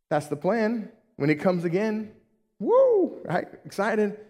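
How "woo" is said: high-pitched "woo"; mock cheer. Preacher cheers for when he comes again, high whoop. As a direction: let out a short, high whoop.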